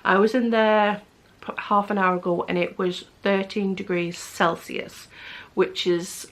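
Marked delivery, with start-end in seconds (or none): none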